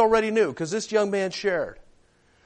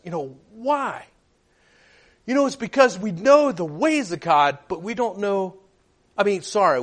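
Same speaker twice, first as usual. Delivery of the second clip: ordinary talk, nothing marked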